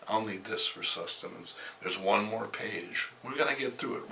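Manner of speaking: normal talking voice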